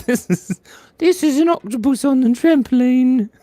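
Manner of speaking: high squeaky voice; squeaky elf voice. Acted. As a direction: present it as the elf. Still in squeaky voice.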